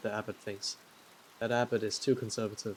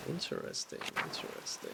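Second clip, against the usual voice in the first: breathy whispered echo; whispering; 9 dB quieter than usual. Real, not acted. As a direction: whisper it to yourself. Echoing the word in a whisper.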